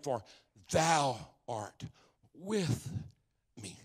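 word by word, long pauses between; measured, softly spaced recitation; deliberate scripture quote. Real, not acted. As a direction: space out each word of the verse. Repeats key verse for emphasis.

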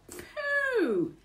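high, drawn-out falling exclamation; long high disgusted swoop; disgusted burglar. A narrator's reading. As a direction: recoil from a bad smell. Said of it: "he says".

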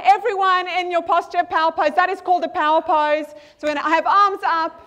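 ordinary talk

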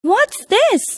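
high-pitched astonished question; surprised cry. A narrator's reading. Mother seeing pearls fall from her daughter's mouth, astonished. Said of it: "cried her mother".

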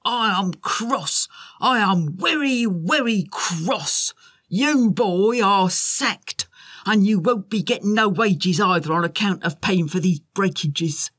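put-on lightly comic Tugbury telling off the boy; cross factory boss. A narrator's reading. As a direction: stretch out the werry, werry cross. Tugbury scolds and sacks James.

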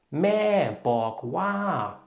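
"Mäborg wa" said slowly, syllable by syllable, as a model; teacher says "Mäborg wa" slowly and clearly; slow Thai demo. Shown as a demonstration. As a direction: spell out "Mäborg wa" slowly for learners. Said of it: "diese Funktion hat das Wörtchen wa".

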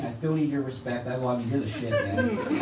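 normal talking voice

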